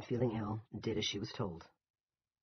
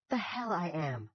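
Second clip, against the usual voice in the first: squawked; 8 semitones higher, 3 dB louder, slower than usual. Acted. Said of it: "Georgia squawked".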